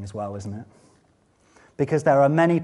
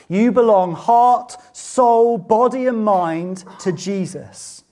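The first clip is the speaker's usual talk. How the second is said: loud, high, slow, every word weighted; emphatic declaration; 8 semitones higher, 9 dB louder, slower than usual. Acted. Preacher pressing the congregation that they belong to Jesus, slow and emphatic. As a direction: drive each word home. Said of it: "emphatic sermon point".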